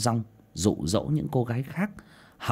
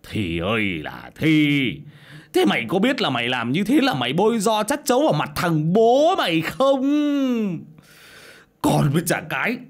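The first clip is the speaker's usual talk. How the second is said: furious father scolding; 7 semitones higher, 6 dB louder than usual. Acted. Father Đảm scolding his pregnant daughter, furious and loud. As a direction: rage at a daughter who shamed him. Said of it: "mà mắng xối mắng xả".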